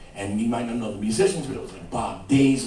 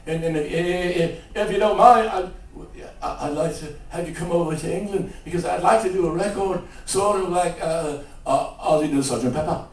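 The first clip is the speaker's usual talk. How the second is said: stammering rock-star caricature, growing louder; 2 semitones higher, 6 dB louder than usual. Retold or imitated. Rock singer inviting a songwriter to England, stammering caricature. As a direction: stumble along, then repeat like a stuck record.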